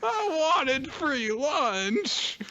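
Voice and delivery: very high, slow, whining sulk; whiny sad Ren. Retold or imitated. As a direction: drag it out like a sulking kid. Voicing Ren's sad complaint.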